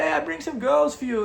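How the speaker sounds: high, eager tout voice; lightly voiced sleazy tout offering girls; pushy club tout. Tout voice retold.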